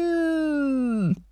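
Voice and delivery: long, drawn-out falling roar, held back in volume; raging animal roar. A narrator's reading. Grandfather's voice turning into an animal, roaring. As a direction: stretch the word into a beast's distant howl. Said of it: "Dann verwandelte sich die Stimme in das Brüllen eines wütenden Tieres".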